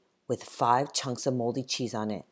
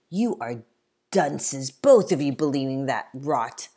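louder, scornful put-down; loud scoff at gullible boys; scornful scolding; 7 dB louder than usual. Acted. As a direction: call them fools with contempt. Samuel scolds the two boys.

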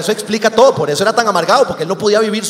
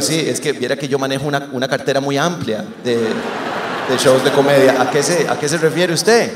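mock customer-service voice; 6 semitones lower, 3 dB quieter than usual. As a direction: play dumb like a patient call-center agent. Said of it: "Le digo yo".

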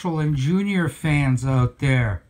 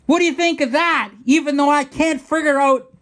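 high, fast burst of vindication; raised voice, rushed and insistent; defiant challenge; 8 semitones higher, 4 dB louder than usual. Real, not acted. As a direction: demand a verdict, all worked up. Triumphant challenge after the demonstration.

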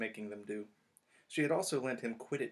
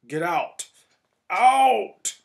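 much higher and much louder, rising to a scream; furious roar; 9 semitones higher, 19 dB louder, much slower than usual. Acted. Snape screaming Harry out of the staff room, furious. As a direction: explode at the intruder. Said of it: "twisted with fury".

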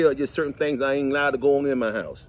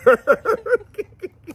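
high laugh; 6 dB louder than usual. Real, not acted. Laughing at own joke.

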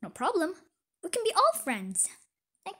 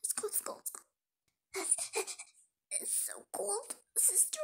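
whispered, softer, cold and small; shivering whisper; 3 semitones higher, 12 dB quieter, slower than usual. Acted. Runaway girl telling her sister it's cold, whispered. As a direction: whisper as if freezing outside.

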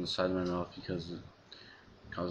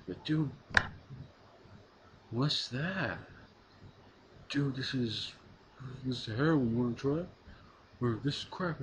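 high, eager party-dude voice; pushy stoner dude; 6 semitones higher than usual. Retold or imitated. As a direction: egg them on like a dealer buddy. Acting out peers pushing drugs.

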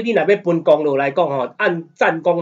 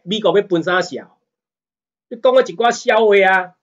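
ordinary talk, nothing marked

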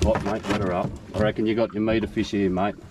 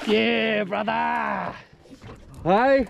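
excited cheer; 10 semitones higher, much slower than usual. Real, not acted. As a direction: cheer your mate like you just won. Big fish landed, cheering.

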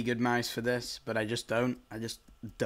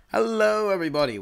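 high, loud hello to viewers; bright big opener for the channel; hyped-up greeting; excited intro; 9 semitones higher, 7 dB louder than usual. Real, not acted.

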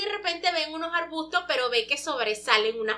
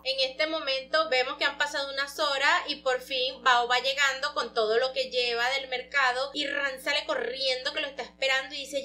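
ordinary delivery

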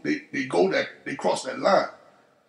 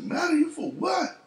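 pitched way up, puzzled and surprised; 12 semitones higher than usual. Retold or imitated. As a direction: act baffled, jump the pitch high. Retold reply, surprised at Buddy.

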